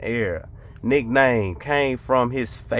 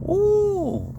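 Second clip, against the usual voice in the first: high ooh. Real, not acted. Reacting to name.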